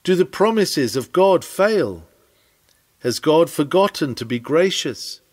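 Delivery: usual speaking voice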